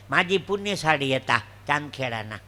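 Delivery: ordinary talk, nothing marked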